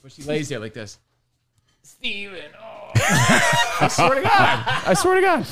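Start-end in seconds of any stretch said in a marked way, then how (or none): 1.8-2.9 man doing a woman's voice, breathy sigh; lightly voiced mom lying there; impression of my mom. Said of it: "it's an impression of my mom". 2.9-5.4 laughing through the words; cracking up while swearing. Real, not acted. Laughing after impression.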